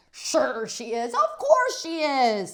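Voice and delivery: slow, loud, rising hearty assurance; reassuring grandpa. Acted. Grandpa assures the teacher, slow and loud. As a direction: boom out reassurance. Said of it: "said my grandpa".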